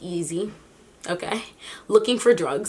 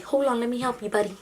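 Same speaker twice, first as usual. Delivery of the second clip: lightly voiced friendly cop; 3 semitones higher, 4 dB quieter than usual. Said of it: "the officer's like".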